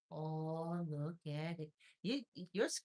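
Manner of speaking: ordinary talk, nothing marked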